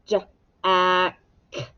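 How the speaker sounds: J-A-K said sound by sound with gaps. Shown as a demonstration. Teacher tapping out sounds for the class, J-A-K sounded out. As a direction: stretch each separate sound of J-A-K. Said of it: "Elbows up".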